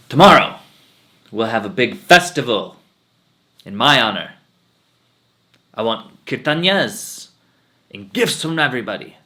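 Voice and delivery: booming, proud announcement to the village; loud, self-important holy man; arrogant swami proclamation. Acted. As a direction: proclaim it loud like a vain guru. Arrogant swami announces his festival.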